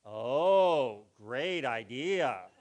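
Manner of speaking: low, slow, flat-dropping oh great idea; mock approval of a bad idea; sarcastic. Shown as a demonstration. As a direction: drip with sarcasm. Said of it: "So we use something called sarcasm".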